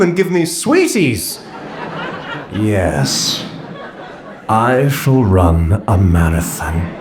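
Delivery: pompous, slowing to a grand proclamation; grandiose fantasy. Retold or imitated. The dreamer vows to run a marathon, grandly. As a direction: declare it like an epic destiny.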